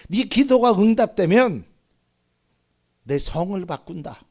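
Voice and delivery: loud high opening, then dropping back; acted sarcasm; sarcastic jeer. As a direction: imitate a sneering unbeliever making a bet. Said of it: "이렇게 비아냥거리는 거예요".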